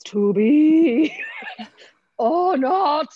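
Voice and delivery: loud, slow, high theatrical declaiming; hammy stage Shakespearean, hugely drawn out; overblown Shakespeare voice. Shown as a demonstration. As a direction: stretch "be" out grandly, rising high. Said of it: "rather than there's".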